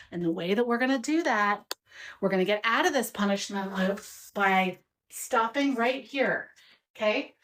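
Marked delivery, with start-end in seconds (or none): none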